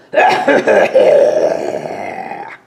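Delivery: a loud rough cough to clear smoke; smoky throat-clearing cough. A narrator's reading. Dragon clears smoke before reading to children, loud cough. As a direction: hack the smoke out of your throat. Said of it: "cleared the smoke from her throat".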